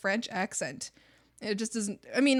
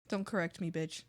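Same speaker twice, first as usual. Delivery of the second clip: low, soft menace; mock threat; 7 semitones lower, 9 dB quieter than usual. Warning people who correct her, quiet mock menace. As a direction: say it low and dry.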